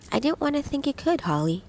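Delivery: ordinary delivery, nothing marked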